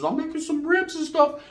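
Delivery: a hint of a sweet girlfriend; her voice. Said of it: "she says".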